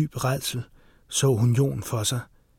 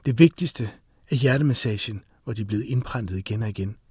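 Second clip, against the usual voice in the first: ordinary delivery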